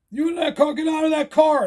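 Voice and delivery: high, urgent shouted orders; yelling cops. Retold or imitated. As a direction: imitate police yelling at a driver. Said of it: "They're yelling".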